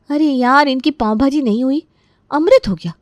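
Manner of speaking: irritated daughter-in-law venting; angry grumbling. Acted. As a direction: complain to yourself, fed up. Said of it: "बड़बड़ाते हुए जाती है, क्योंकि आज उसका गुस्सा सातवें आसमान पर था".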